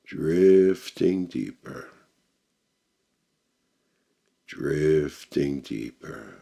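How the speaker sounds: slow, soothing meditation voice with long pauses; measured hypnotic delivery, settling at phrase ends; guided meditation. Acted.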